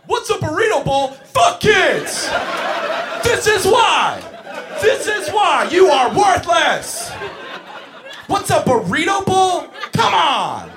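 high, loud mock-furious screaming; hammed-up furious yelling at a child; screaming rant. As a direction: scream at the kid as if he ruined your day. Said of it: "You're like".

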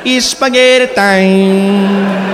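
very slow, drawn-out and dreamy; sleepy catchphrase. Shown as a demonstration. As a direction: ease into it like drifting off. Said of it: "Say that catchphrase to yourself as you drift off to sleep".

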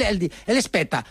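ordinary talk

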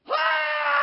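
sudden high shriek of fright; startled scream. Retold or imitated. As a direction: let out a high terrified yelp.

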